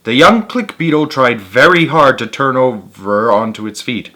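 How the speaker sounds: ordinary delivery, nothing marked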